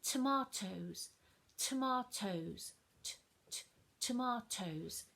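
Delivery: tomatoes slowed right down, both t sounds crisp; slow stressed model. Shown as a demonstration. Teacher showing children both t sounds in tomatoes, slowly. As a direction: stretch out tomatoes, punching each t. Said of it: "I can hear it in the middle too".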